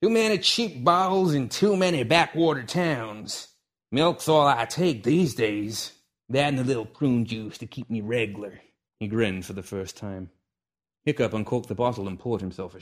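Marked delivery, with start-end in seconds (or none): 0.0-8.6 put-on old cowboy voice, higher and slower; old man's drawl. Old gunfighter telling the kid about his habits, in a slow old-timer voice. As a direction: reminisce like a weathered old gunslinger.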